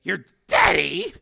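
derisive. Acted. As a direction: throw her word back with scorn. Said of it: "he said with derision".

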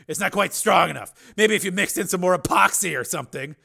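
loud, high-pitched bitter rant; harsh. A narrator's reading. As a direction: taunt her harshly at full volume. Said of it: "he said harshly".

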